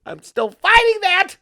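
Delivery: high, loud, drawn-out cry; mock anguish. Comic outburst after villain skit.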